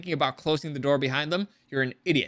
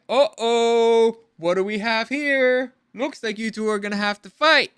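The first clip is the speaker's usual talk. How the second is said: higher, louder, gleeful taunt; giddy villain; 5 semitones higher, 5 dB louder than usual. Retold or imitated. Gideon gleefully telling the two to fight, higher and louder. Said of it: "he's kind of a bit too giddy about it".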